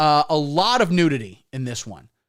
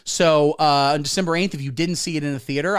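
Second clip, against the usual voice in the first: usual speaking voice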